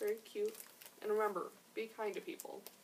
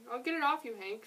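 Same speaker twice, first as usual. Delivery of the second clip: raised storybook call, falling on the name; mild shout; 3 semitones higher, 6 dB louder than usual. A narrator's reading. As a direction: lightly shout an offer of help. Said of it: "she shouted".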